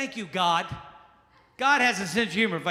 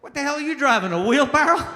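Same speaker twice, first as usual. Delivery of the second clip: mock retort; 7 semitones higher, 8 dB louder than usual. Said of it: "comic retort".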